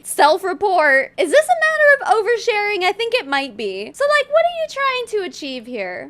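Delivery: high, loud disbelieving questions; incredulous. Real, not acted. Vlogger reacting to a guest's overshare, high and incredulous. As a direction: call it out in disbelief.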